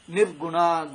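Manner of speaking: usual speaking voice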